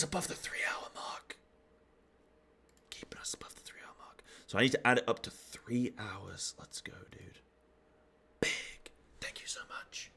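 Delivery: breathy whispered thanks; whispering. Real, not acted. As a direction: drop to a hushed whisper.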